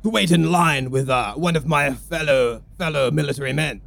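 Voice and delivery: hearty officer voice. Acted. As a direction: greet him like a fellow officer. Military gent greets commodore.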